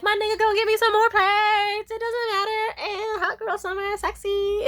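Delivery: high, loud carefree brag; rich party girl. Retold or imitated. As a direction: show off, tossing money around. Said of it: "And she'd be like".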